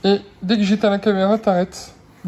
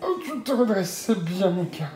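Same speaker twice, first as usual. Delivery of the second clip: softer, fond praise with a little laugh; tender praise; 8 dB quieter than usual. Real, not acted. Parent praising baby who sits up, tenderly. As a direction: praise her gently.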